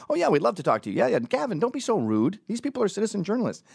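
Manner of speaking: high, mock-agreeable imitation; mimicked obliging colleague. Ezra telling Gavin not to be rude, high mock-friendly voice. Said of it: "he's the opposite".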